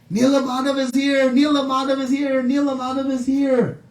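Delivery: high, chant-like jubilant call; ecstatic cry. Retold or imitated. Villagers crying out the Lord's arrival, ecstatic. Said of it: "they become ecstatic".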